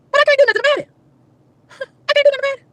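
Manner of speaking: high-pitched mock-tearful whine; helpless whine. Woman stuck while mover is unmasked, helpless whine.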